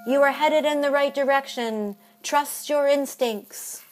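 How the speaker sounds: fortune-teller read. Acted. Reading fortune.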